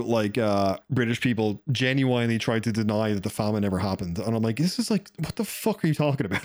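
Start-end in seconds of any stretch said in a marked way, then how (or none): 4.6-6.4 retold disbelief. Retold exasperated retort.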